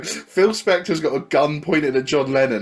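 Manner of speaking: ordinary delivery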